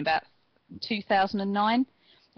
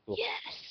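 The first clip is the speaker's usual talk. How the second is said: yes chant; 10 dB quieter than usual. Retold or imitated. Daniel Bryan yes chant.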